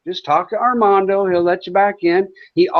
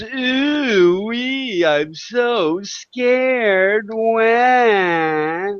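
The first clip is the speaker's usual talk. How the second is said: slow, hammed-up scared voice ending in a fake wail; mock fear; 3 semitones higher, much slower than usual. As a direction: stretch it out like a pretend crybaby. Mocking a motorcycle gang threat.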